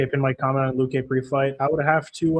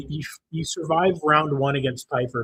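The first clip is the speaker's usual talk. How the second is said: ordinary delivery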